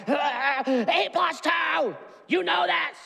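hammed-up God stretching out eight plus two; exasperated God. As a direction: play God as the fed-up dad. God voiced as frustrated parent.

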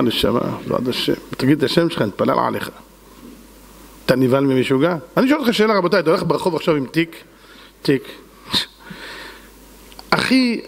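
ordinary talk

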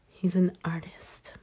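unvoiced whisper of He's an artist. Shown as a demonstration. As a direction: whisper it like a proud secret.

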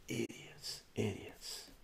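disgusted whisper to yourself; muttered under breath. Real, not acted. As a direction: shake your head and whisper it. Muttered disgust after story.

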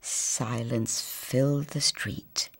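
soft, slow near whisper; hushed narration. A narrator's reading. Narrator hushing the scene for the children. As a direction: let the silence settle.

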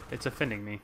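usual speaking voice

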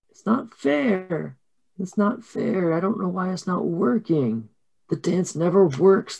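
put-on high, soft, drawn-out child whine; mock tearful little-girl complaint; crying girls. Twins complain that their dance failed, high plaintive whine. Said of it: "the girls start crying".

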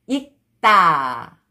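익다 joined as 익따, tense tt; read together. Shown as a demonstration. Teacher shows how it reads together, linked pronunciation. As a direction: run 익다 into one word with a hard 따. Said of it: "when you read it together".

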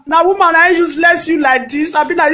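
usual speaking voice

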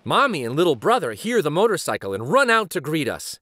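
usual speaking voice

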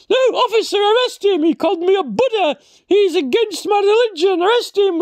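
high, indignant whine; mock offended complainer. Complaining to the officer, high and indignant. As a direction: play the easily offended passenger.

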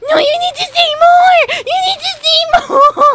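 high, loud laughing yell; excited shout. Real, not acted. Urging character, laughing shout.

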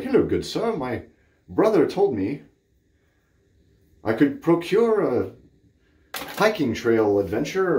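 high, slow put-on gentleman voice, playing a disguised prankster; a silly disguised gentleman, hammed-up and lofty; fake fancy gentleman. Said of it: "with the nose and the mustache and be like".

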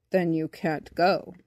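low, slow verdict; refusing bumblebee. A narrator's reading. Bumblebee tells flightless boy no, low and final. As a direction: lay it down slowly, pitched low.

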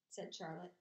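plain reading voice

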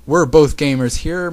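high, loud whiny protest; loud, aggrieved complaint; whining. A narrator's reading. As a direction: whine like a sore loser. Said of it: "Misao whined".

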